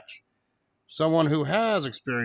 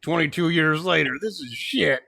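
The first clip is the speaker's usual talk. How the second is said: high, loud exasperated complaint; mock rant; 6 semitones higher, 5 dB louder than usual. Fan complains about seeing the feud revived, mock rant. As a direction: protest it loudly, half in jest.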